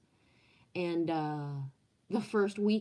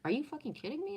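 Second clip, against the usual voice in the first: high incredulous outburst; 9 semitones higher, much faster than usual. Retold or imitated. Accountant says costs exceed earnings.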